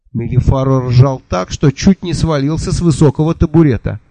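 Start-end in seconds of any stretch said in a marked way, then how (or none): none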